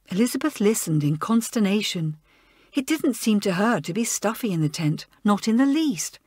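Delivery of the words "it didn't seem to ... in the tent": ordinary delivery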